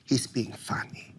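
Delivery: very soft, nearly whispered; hushed reassuring mom. Retold or imitated. Mom quietly calms her shaken son, hushed and reassuring. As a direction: hush it down to soothe your son.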